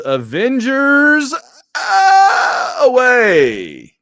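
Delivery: high, loud, long-drawn rallying call; hammed-up heroic call, stretching every word; battle cry. Calling the Avengers to action, almost saying Assemble, battle cry.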